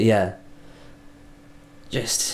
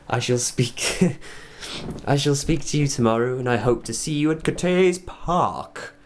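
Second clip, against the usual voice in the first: put-on a light Scottish accent, slowed, rising high by the end; hammed-up Scottish goodbye; mock Scottish accent; 4 semitones higher than usual. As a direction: sign off in a put-on Scottish voice, laughing through it. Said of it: "start speaking in a Scottish accent".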